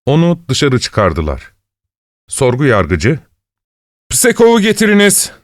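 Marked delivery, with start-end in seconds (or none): 4.1-5.3 high-pitched called order; commanding. A narrator's reading. Investigating judge orders Psekov brought in, commanding. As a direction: issue a summons in a raised pitch. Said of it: "emrini verdi".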